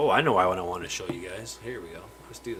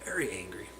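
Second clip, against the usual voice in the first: whispered aside; 2 semitones lower, 7 dB quieter than usual. Real, not acted. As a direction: drop to a whisper for the joke. Aside about the angry fish.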